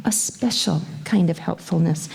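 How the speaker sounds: ordinary talk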